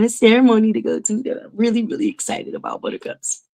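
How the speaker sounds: near-tears whisper; real emotion catching in the throat; choked up. Real, not acted. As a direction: voice fading to a breath, about to cry. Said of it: "Look, I'm about to cry now".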